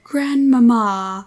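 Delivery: slow, loud, drawn-out Grandmama; mock exasperated quote. Reader mocking a mouthful of a name, drawn out. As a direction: draw the name out loudly. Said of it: "every time she was like".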